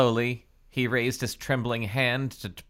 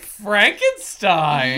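mock questioning shout; 8 semitones higher, 10 dB louder, much slower than usual. Listener mimics the exaggerated shout.

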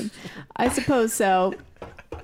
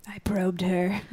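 whispering; 3 semitones lower, 4 dB quieter, slower than usual. Real, not acted. Whispered aside.

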